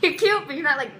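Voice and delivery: loud, sassy comeback; indignant retort. Retold or imitated. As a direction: put him in his place loudly. Retort to boyfriend, retold.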